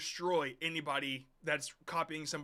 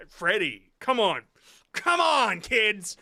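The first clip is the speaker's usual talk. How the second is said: exasperated loud; 3 semitones higher, 11 dB louder, slower than usual. Real, not acted. Exasperated at the AI video.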